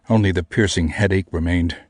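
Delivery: plain reading voice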